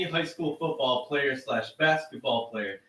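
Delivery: normal talking voice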